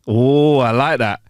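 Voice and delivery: ordinary talk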